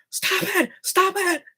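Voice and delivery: high, strained yell; really yelling. Shown as a demonstration. Alone in a parking lot, yelling at monsters. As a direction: let it rip, no one can hear. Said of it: "I have to really yell".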